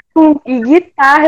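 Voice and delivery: normal talking voice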